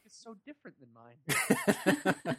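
quoting The Room. Acted. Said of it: "we're obviously quoting from".

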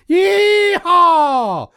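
high, loud hollering yee-haw; Texan cowboy whoop. Retold or imitated. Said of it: "punctuates every third syllable with".

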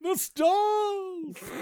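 high, loud dramatic cry; Byron Orpheus voice. Pretend. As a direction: shout it like a hammy wizard. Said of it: "turning him into Byron Orpheus".

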